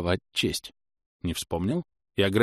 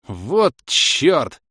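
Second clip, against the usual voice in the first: loud, higher outburst; exclaimed curse; 6 semitones higher, 7 dB louder than usual. A narrator's reading. Inquisitor seeing the witch's attack, loud outburst. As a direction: cry out in alarm. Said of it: "выкрикнул я".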